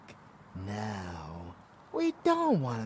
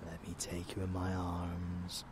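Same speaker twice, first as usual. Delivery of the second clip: usual speaking voice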